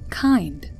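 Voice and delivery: ordinary delivery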